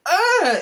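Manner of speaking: loud, high "ugh" of annoyance; exasperated groan. Real, not acted. Fed up with the ego battles, exasperated groan.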